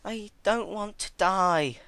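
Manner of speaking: high, slow, tearful plea; crying. Acted. Celestia begging for her life, crying. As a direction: whimper it through tears. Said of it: "cried miserably".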